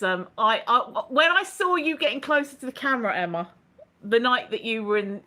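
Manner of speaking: usual speaking voice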